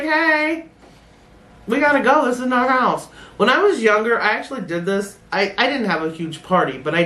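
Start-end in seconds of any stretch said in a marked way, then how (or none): 0.0-3.1 mock alarm. Retold panicked exit.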